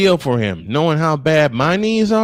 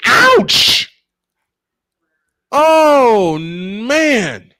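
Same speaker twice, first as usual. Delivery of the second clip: much higher and louder, wincing exclamation; pained outcry; 10 semitones higher, 6 dB louder, much slower than usual. Real, not acted. Broadcaster seeing batter hit by pitch, loud pained outcry. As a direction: yelp as if you felt the pitch yourself.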